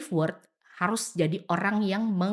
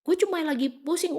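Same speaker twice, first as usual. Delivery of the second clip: defensive retort; 8 semitones higher, 4 dB louder than usual. Retold or imitated. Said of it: "Padahal gue mungkin ya".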